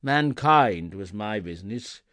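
loud, mournful ghostly lament; unearthly voice. A narrator's reading. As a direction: proclaim it in regret.

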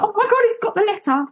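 high, excited exclamation; retold excitement. Excited that letter has arrived.

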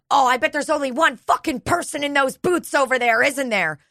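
high-pitched retold outburst; imitating someone losing it. Agitated patient to front desk staff, mock losing it. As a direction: mimic an agitated patient kicking off. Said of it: "then she starts losing it".

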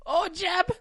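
high, put-on lovesick call; mock swooning. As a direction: call him in falsetto. Mock romantic name calling.

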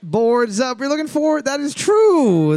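high, loud quiz host call; announcer call. Acted. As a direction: boom it out like a game show host. Host reveal.

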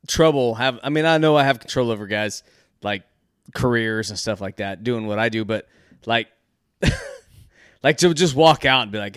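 usual speaking voice

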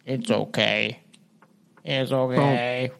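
low, soft ghostly comfort; soft reassuring. Retold or imitated. Ghost comforts the crying woman, low and soft. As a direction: soothe her gently like a ghost. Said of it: "a male voice said softly".

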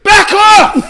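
shouting. Pretend. Shouting at TV license man.

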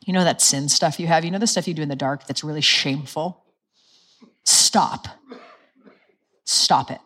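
a harsh mom tone, cold and disapproving; stern scolding. Shown as a demonstration. God imagined as stern parent scolding shameful sin. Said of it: "I would think God's tone's like this".

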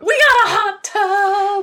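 high loud sing-song announcement; excited. Real, not acted. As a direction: belt it out with joy. Announcing the hot tub news.

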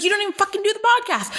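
high, fast exasperated retort; annoyed mom. Retold or imitated. Mom to jobless podcaster daughter, mock annoyed. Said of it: "she gets annoyed with me".

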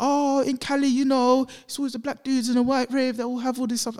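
high-pitched mock Cali girl; mimicked Californian woman. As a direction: explain it in her high Californian voice. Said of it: "I'm from California".

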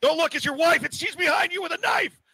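high shrieking panto warning; shrill camp pantomime dame. Pretend. Panto-style warning about the wife.

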